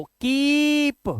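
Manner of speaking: कीप with a long held ee; slow, drawn-out कीप; long-vowel demo. Shown as a demonstration. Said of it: "अब कैसे पढ़ेंगे".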